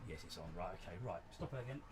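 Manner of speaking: ordinary talk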